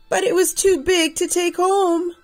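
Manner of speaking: ordinary delivery, nothing marked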